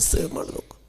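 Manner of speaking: ಸೇವೆ ಮಾಡಬೇಕು breathed as a bare whisper; whispered echo. Shown as a demonstration. Pastor repeating his call to serve, dying to a whisper. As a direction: let the last ಸೇವೆ ಮಾಡಬೇಕು fade into a whisper.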